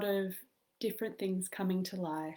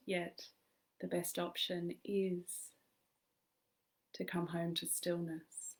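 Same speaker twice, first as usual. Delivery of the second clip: calm meditation guide with long pauses; measured, soothing guidance in short phrases; guided meditation. Acted. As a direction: lead listeners gently into stillness, leaving space to breathe.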